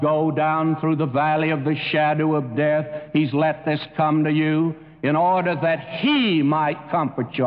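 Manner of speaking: ordinary talk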